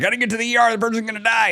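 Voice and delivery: a frantic driver's high urgent cry; panicked driver. Retold or imitated. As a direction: yelp it like someone racing a dying friend.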